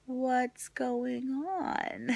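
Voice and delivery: slow, hushed, drawn-out question; high, soft, hesitant mock concern; unsure what to do. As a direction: ask gingerly, stretching every word. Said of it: "looks at me like, I've got no idea how to help you".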